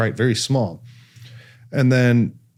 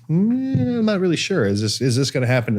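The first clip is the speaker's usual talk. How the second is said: long high "mm", then hesitant doubt; mock skeptical wavering, pitched up; retold doubters; 5 semitones higher than usual. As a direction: imitate unsure onlookers in a raised voice. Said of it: "a lot of people are like".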